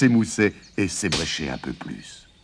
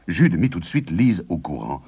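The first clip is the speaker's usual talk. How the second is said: ordinary delivery, nothing marked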